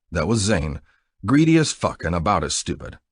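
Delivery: usual speaking voice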